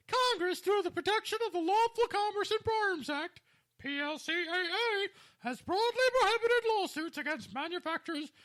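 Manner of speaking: high-pitched put-on woman's voice. Host reading a judge's decision aloud, in a woman voice. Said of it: "Your woman voice sucks".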